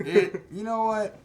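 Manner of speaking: jumps up high on know what; bursting out mid-thought; sudden outburst. Real, not acted. Friend reviewing Moonlight, suddenly excited.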